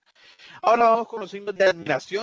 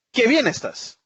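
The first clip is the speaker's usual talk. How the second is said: exclamation; 7 semitones higher, 4 dB louder, slower than usual. Shown as a demonstration. Teacher voicing an exclamation.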